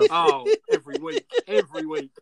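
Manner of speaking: laughing through the words; squeezing the words out between laughs. Real, not acted.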